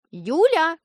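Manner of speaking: calling out from the kitchen; from another room. A narrator's reading. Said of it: "крикнула бабушка из кухни".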